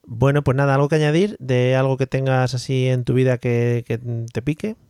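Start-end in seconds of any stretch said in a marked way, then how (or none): none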